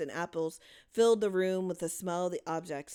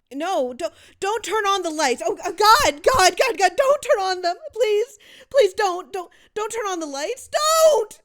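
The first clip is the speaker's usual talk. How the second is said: much higher, much louder terrified shriek; 14 semitones higher, 14 dB louder than usual. A narrator's reading. Louise begging the adults not to turn on the lights, shrieking. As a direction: shriek and beg in panic. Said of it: "Louise was shrieking now".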